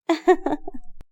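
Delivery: high, light giggle; delighted giggle. A narrator's reading. Daughter pampered by her mother, giggling.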